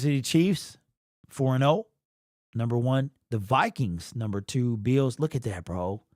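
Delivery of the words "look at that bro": ordinary talk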